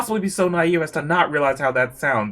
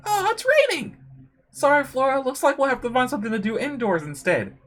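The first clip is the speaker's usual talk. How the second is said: high-pitched character voice, lightly put on; apologetic game character; 7 semitones higher than usual. Telling Flora about the rain, high apologetic character voice.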